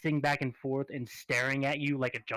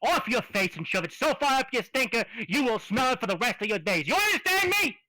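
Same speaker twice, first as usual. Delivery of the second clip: angry tough-guy raccoon; 5 semitones higher than usual. Acted. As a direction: bark at them like a caged street tough. Raccoon threatens the party.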